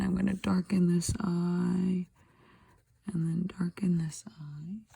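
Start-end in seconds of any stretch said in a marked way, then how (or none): 3.1-4.8 whispered aside to herself; soft whisper while shading. Real, not acted. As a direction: breathe the words out unvoiced.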